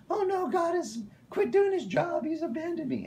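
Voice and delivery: very high, wailing mock panic; hammed-up falsetto despair; mock freak-out. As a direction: imitate people panicking, way up high. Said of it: "people freak out and they're like".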